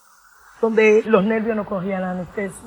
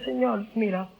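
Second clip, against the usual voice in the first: oración en voz baja; 3 semitones lower, 9 dB quieter than usual. Retold or imitated.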